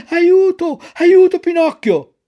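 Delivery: high, loud frightened call; cry for help. Acted. Lucignolo to his friend, crying out for help in fright. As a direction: shout for help as you stagger.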